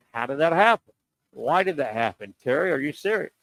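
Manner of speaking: ordinary talk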